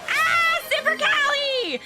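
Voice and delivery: high, laughing cheer; laughing shout. Real, not acted. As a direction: shout their name, laughing. New donor, laughing shout.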